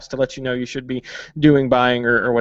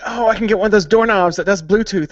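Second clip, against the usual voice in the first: higher-pitched retold eagerness; retold excitement; 6 semitones higher than usual. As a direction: act out your own gadget excitement, lightly. Retelling own excitement while house shopping.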